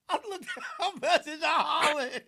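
high, loud talk through laughter; laughing hard. Real, not acted. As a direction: barely get it out for laughing. Cracking up over listener's message.

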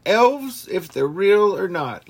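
high, loud and slow, almost squealing; a silly high rant on elves; excited joking rant. Real, not acted. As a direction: get worked up, half joking, about elves. Jokey rant about elves.

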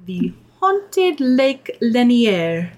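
slow, drawn-out spooky reveal; dramatic announcement. Acted. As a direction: announce it like a ghost story title. Announcing her story dramatically.

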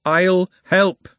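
quick, loud, high blurt; sudden loud offer. A narrator's reading. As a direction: blurt out "help" too fast. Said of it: "shocked at the speed of her response".

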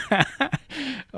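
high giggle fit; laughing you can't hold back; stifled giggles. Real, not acted. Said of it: "I can't even keep a straight face".

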